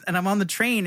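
usual speaking voice

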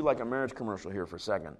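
fast, dropped-low throwaway; quick aside. Real, not acted. Pastor joking to the congregation, quick low aside. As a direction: rattle it off as an aside. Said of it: "maybe I should do".

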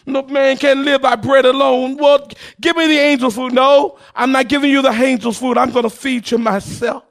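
ordinary talk

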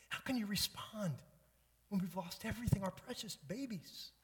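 high-pitched, bitter reproach; anguished accusation without shouting; wife's vitriol. Acted. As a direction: plead bitterly as the aggrieved wife. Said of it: "Can you hear the vitriol in her voice?".

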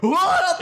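high squeaky surprised yelp; funny yelp. Shown as a demonstration. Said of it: "Can you do it for us?".

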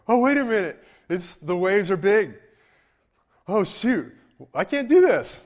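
high, loud hammed-up panic; mock panic. Peter's fearful thoughts on the waves, as hammed-up panic. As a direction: play a frightened man losing his nerve, over the top. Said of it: "his fear comes into his mind and you go".

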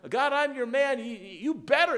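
loud, high-pitched demand to God; mock an insistent prayer, voice pushed up and loud. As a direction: act out an entitled, demanding cry. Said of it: "not crying out ... It's not like that. He's not making a demand of God".

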